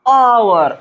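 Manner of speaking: आवर with a long, held opening "aa"; teacher's drawn-out model of आवर, h left silent; silent-h pronunciation demo. Shown as a demonstration. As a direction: say आवर slowly as "aaa-war", no h. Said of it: "इसे क्या पढ़ते हैं".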